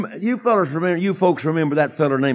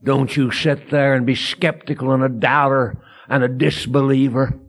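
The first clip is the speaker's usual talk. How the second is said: ordinary delivery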